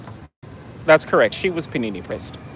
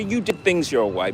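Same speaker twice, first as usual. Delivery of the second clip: ordinary talk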